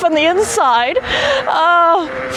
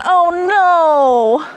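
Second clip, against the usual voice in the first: ordinary talk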